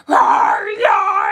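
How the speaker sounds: high, loud growling roars; mock roaring yell; mock monster screams. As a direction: roar like the chaos in her head. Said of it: "all this screaming in my mind".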